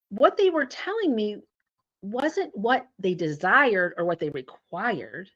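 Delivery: normal talking voice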